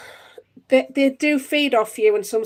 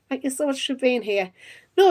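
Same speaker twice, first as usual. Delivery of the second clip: ordinary talk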